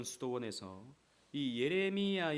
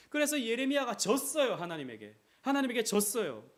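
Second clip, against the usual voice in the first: preaching emphasis; 10 semitones higher, 9 dB louder than usual. Acted. Preaching at full force.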